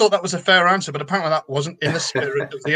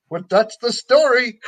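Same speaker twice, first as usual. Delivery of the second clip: mock catchphrase; 5 semitones higher, slower than usual. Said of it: "Every so often you'll just go".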